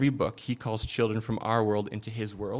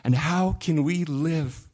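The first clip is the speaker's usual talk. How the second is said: higher, tearful pleading, slowing down; sobbing Lucy; 3 semitones higher, 5 dB louder, slower than usual. A narrator's reading. Pastor reading Lucy's sobbing reply to Aslan, tearful. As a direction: read Lucy's lines as a child in tears. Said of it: "sobbed Lucy".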